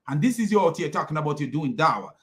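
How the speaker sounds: ordinary talk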